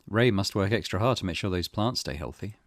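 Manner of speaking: normal talking voice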